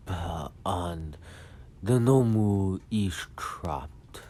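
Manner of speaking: slow, ponderous henchman voice; attempted solemn servant voice, heavily drawn out; Kirigiri. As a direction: stretch every word as a formal warning to the master.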